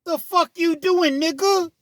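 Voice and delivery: high, loud, slow outraged yell; furious confrontational shout; angry retort. Retold or imitated. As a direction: snap back at him, way up high. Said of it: "When he said that".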